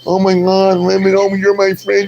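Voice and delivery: mock tearful wail, climbing high; hammed-up weepy outburst; Sassy crying. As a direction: imitate someone blubbering, pitch rising as it goes. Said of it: "Sassy started crying".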